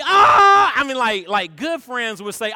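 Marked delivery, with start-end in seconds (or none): 0.0-0.7 much higher, louder alarmed shout; shocked yell. Retold or imitated. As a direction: yell as the friend crashes. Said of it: "And we're like".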